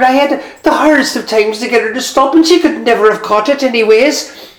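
high-pitched flustered neighbour rambling on; Tony the carter. Acted. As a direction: babble it out like an excited old gossip. Tony's voice, high character.

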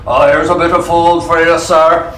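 a hint of a low-voiced butler; lightly voiced butler. Butler bringing a tray to Robin, lightly voiced. Butler serves food in the story.

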